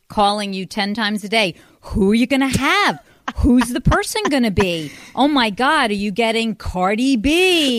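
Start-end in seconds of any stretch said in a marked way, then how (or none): 1.8-7.8 loud, high re-enacted nagging questions; excited pestering. Mother re-enacting her calls to daughter, excitedly pestering. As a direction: imitate breathless guessing, pitched up and loud.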